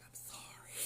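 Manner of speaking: hushed, voiceless sympathy; whispered apology. Retold or imitated. Nurse quietly sympathizes with patient, whispered apology.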